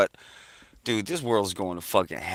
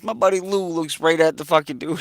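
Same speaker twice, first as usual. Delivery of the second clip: ordinary talk, nothing marked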